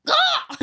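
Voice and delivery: loud, high imitated crow caw. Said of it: "I just go".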